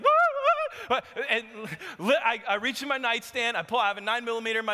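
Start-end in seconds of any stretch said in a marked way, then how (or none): 0.0-1.0 shocked, high-pitched disbelief, a little laugh; a wife's sudden high-pitched "What, what?"; startled what. Retold or imitated. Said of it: "Anna's like".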